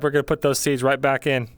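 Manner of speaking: ordinary delivery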